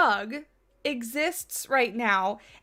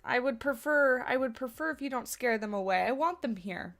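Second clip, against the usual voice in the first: usual speaking voice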